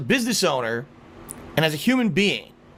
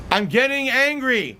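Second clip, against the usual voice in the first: higher-pitched, mounting irritation; growing anger; 6 semitones higher than usual. Real, not acted. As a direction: let the temper start to rise.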